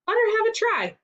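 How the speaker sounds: high cheery gentleman's allowance. A narrator's reading. Gentleman voices approval.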